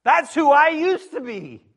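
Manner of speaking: high, loud cry of shock; shocked yell. Pretend. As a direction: shout it like you caught yourself. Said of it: "We need to go".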